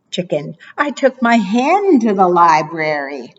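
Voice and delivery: ordinary talk, nothing marked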